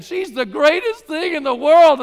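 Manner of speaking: high, loud and slow, gushing over her; mock lovestruck rave; loud gushing praise. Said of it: "they're saying".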